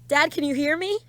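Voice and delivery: high, urgent call to dad. Acted. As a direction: reach through the glass to him. Calling to father in car.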